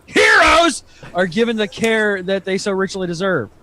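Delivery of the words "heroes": mock presidential yell; all-caps yell. Podcaster reading a tweet's all-caps word, mock yell. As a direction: burst out loud on the one word. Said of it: "I'm assuming he just yelled it".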